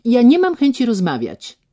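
loud, icy refusal; loud and cold. Acted. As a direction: shut him down coldly and loudly. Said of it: "rzekła głośno i zimno".

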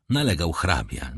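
ordinary delivery, nothing marked

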